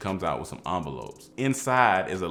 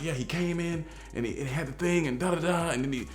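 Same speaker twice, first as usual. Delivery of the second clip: higher, rushed telling of the story; frantic retelling; 8 semitones higher than usual. Retold or imitated. Frantically telling the prayer partner what happened, high and rushed. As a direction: spill it all out to the prayer partner in a panic. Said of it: "I'm frantically telling her".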